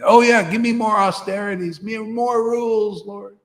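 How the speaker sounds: hammed-up eager follower; mock eager disciple. Mocking disciple asking austerities.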